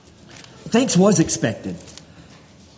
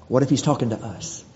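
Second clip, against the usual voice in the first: normal talking voice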